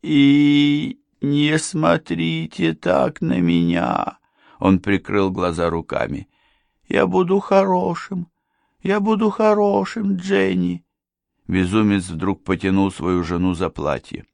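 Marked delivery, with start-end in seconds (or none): 0.1-4.2 high, very slow plea; frightened madman. A narrator's reading. Madman begs not to be stared at, high fearful plea. 6.9-10.8 put-on high, childlike pleading; pleading madman. A narrator's reading. Madman begs his wife, high plaintive promise.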